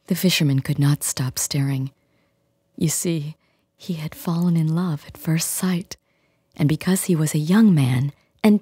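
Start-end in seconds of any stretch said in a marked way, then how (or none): none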